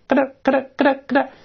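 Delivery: high, rapid-fire little clicks; imitated light clicking. Shown as a demonstration. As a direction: mimic a string of quick clicks. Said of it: "就模拟那个声音". (又高又快地模拟咯噔声)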